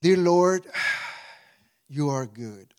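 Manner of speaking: ordinary talk, nothing marked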